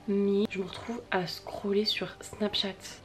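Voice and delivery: slow and whispered; drawn-out whispered confession. Acted. Repeats the phrase twice.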